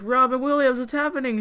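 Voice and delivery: high-pitched alarmed question; mock panic. Friends asking about choking Pooh, panicked. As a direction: call out in comic panic.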